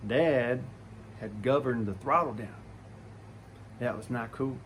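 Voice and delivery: usual speaking voice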